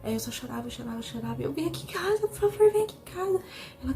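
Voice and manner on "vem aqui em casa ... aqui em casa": high, loud pleading cry; desperate plea. Retold or imitated. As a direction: beg her to come, voice cracking up high. Begging aunt to come over. (súplica desesperada, aguda e alta)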